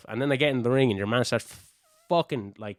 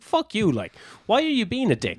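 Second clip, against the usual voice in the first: higher, offended comeback; indignant retort; 9 semitones higher than usual. Retold or imitated. Young wrestler snapping back at a colleague who belittled him, indignant. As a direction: fire it back, stung.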